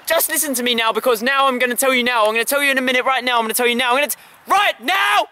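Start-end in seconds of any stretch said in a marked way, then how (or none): 3.9-5.3 shout. Acted. Kid's mock rant builds up.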